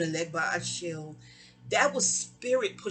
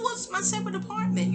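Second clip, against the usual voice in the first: defensive pitch jump; 13 semitones higher than usual. Retold or imitated. Defending her move to friend.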